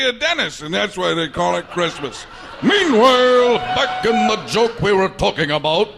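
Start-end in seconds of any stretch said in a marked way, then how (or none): 2.6-5.9 announcer line through laughter; laughing announcer. Retold or imitated. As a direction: boom it out while cracking up. Announcer bit while laughing.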